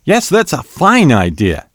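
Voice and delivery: high-pitched, keen agreement; eagerly chiming in. A narrator's reading. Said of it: "Harris chimed in quickly".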